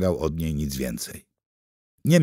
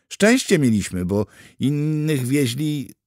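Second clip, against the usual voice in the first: higher, slowing into hesitation; hesitating; 7 semitones higher, 4 dB louder than usual. A narrator's reading. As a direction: start surely, then drag the last words. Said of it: "zawahała się". (z wahaniem, coraz wolniej)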